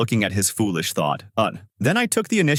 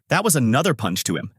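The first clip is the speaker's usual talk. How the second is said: ordinary delivery, nothing marked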